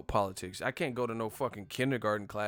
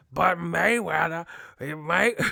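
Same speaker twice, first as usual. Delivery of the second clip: loud, higher, slow cranky promoter; Bob Arum impression; 6 semitones higher, 9 dB louder, slower than usual. Bob Arum answering about Mayweather, cranky impression. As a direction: grumble like a grouchy old promoter. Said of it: "That's how Bob Arum would do it".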